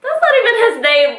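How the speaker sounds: high, loud retold exclamation; amused calling back. Correcting friends as they drive off, high loud call.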